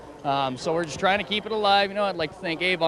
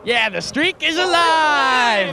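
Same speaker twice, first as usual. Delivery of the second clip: real victory shout, high and drawn out; okrzyk triumfu; 9 semitones higher, 7 dB louder, much slower than usual. Real, not acted. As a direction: shout it long, loud and high in celebration. Winning driver celebrates victory.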